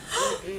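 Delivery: sharp high gasp; surprised gasp. Acted. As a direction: gasp in alarm. Shocked reaction.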